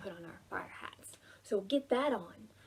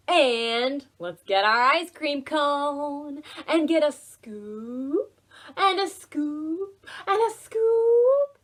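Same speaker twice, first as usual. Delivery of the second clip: slow, growing louder and higher; excited build-up; 4 semitones higher, 8 dB louder, slower than usual. Acted. Teacher pretending to stack ice cream scoops for kids, slow and building. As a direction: stretch each scoop, rising to a thrilled peak.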